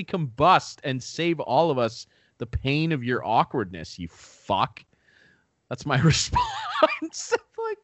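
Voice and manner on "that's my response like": slow, high, laughing through it; much higher and slow, dissolving into laughter. Real, not acted. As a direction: crack up at your own punchline. Laughing at own rant.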